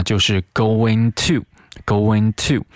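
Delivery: ordinary talk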